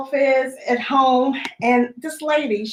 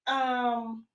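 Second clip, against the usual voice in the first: ordinary delivery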